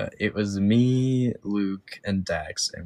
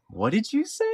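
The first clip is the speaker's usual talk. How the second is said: high, softer mock disbelief; shocked mother; 13 semitones higher, 4 dB quieter than usual. Imitating Kim's reply to the swearing, shocked high voice. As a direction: imitate an appalled mom in a high voice. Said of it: "I just hear Kim go".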